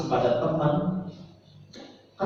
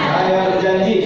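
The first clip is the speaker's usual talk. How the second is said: pledge leader; 5 semitones higher, 8 dB louder than usual. Shown as a demonstration. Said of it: "siap praktek?".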